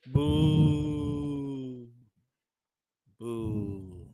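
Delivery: ordinary delivery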